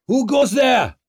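high, loud sentry's roar; full-throated warning shout; roared challenge. A narrator's reading. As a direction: bellow a challenge into the night. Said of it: "Jin roared into the night".

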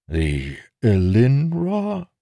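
slow, questioning echo, pitch climbing; hesitant, high-rising query; puzzled. Acted. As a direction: repeat an unknown name in bafflement. Taln pauses, puzzled by the name.

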